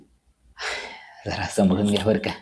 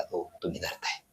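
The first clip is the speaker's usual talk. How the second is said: near-whisper, much softer; hushed accusation; 7 semitones lower, 15 dB quieter than usual. Acted. Accusing the audience of insisting, hushed and much softer. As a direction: drop to a bitter hush.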